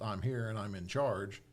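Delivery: ordinary delivery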